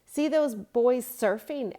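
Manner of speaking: loud, high, slow and amazed; excited boy. A narrator's reading. Boy pointing out surfers to friends, loud and amazed. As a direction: point it out in wonder. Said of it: "asked Luke".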